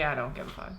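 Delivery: low, soft I-don't-care shrug; indifferent. Retold or imitated. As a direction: toss it away with no interest. Quoting indifferent self.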